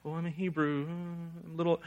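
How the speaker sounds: soft, hesitant, apologetic Hebrew; timid mumble. Shown as a demonstration. Preacher shows how Jonah did not say it, softly timid. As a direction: mumble it like you're embarrassed. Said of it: "Not like".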